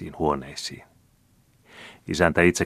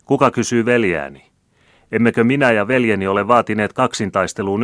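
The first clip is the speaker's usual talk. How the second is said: higher, louder patriotic cry; rousing oration; 5 semitones higher, 7 dB louder than usual. A narrator's reading. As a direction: build to a cheer. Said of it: "melusi mielensä muuttanut väkijoukko".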